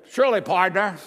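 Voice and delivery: loud, cowboy drawl on partner; mock John Wayne cowboy; hammed-up Western drawl. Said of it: "it sounded like".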